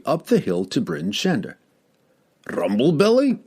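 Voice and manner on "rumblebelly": high, startled dwarf's cry of a name; a dwarf surprised to see his friend carried past; surprised exclaim. A narrator's reading. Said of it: "exclaimed the dwarf".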